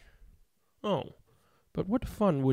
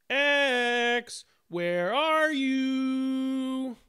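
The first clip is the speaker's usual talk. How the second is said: very slow, drawn-out call, higher; 8 semitones higher, much slower than usual. A narrator's reading. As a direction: stretch it like calling across a field. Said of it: "the other letters called".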